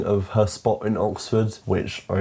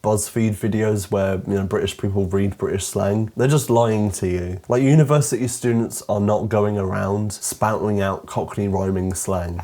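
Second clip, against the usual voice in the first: ordinary talk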